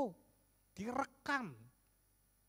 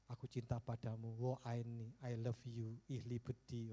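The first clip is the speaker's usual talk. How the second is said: softly and lower, like a sweet confession; tender love words; 8 semitones lower, 9 dB quieter, slower than usual. Shown as a demonstration. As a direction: murmur it gently to your spouse.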